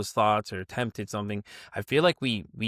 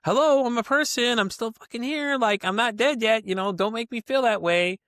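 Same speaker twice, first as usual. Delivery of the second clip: higher and louder, fed up and indignant; exasperated outburst; 9 semitones higher, 4 dB louder than usual. Retold or imitated. Retelling how people treated him after his diabetes news, raised exasperated voice. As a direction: insist you're still alive, half shouting.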